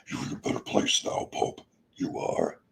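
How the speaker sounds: hushed, breath-heavy devil voice; low Satan, kept soft and husky; deep Satan voice. Acted. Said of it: "my deep voice when I do Satan".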